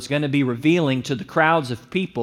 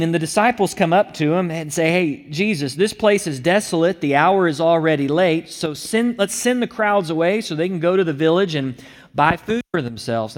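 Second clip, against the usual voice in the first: preaching at full force; 3 semitones higher, 3 dB louder than usual. Acted. Disciples voiced in sermon.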